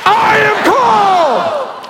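very high, slow, loud shout; shouted declaration. Shown as a demonstration. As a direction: proclaim it with full force. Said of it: "we're gonna shout out".

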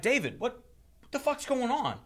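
high-pitched, retold incredulous question; fed-up customer, reenacted; exasperated demand. Fed-up customer confronts service guy.

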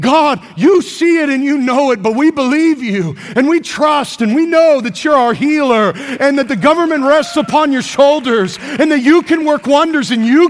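fervent prayer. Acted. Models faith-filled prayer.

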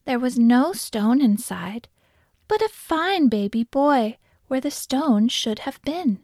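plain reading voice

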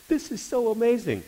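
very high, excited imitation; amazed friend. Friend marvelling after praying for waitress, excited.

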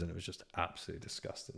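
whispered fast in disgust; a quick whispered shudder. Real, not acted. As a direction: hiss it out, grossed out. Disgusted aside about being ill.

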